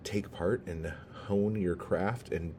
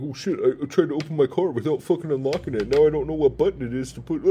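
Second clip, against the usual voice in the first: mocking a flustered man; 8 semitones higher, 7 dB louder, much faster than usual. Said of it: "It's like".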